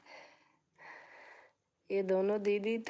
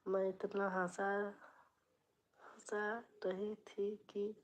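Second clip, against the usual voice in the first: near-whispered, much softer, breaking into breath; hushed; 13 dB quieter than usual. Real, not acted. Telling a friend how hard she laughed, in a hushed near-whisper. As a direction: drop almost to a whisper while recounting.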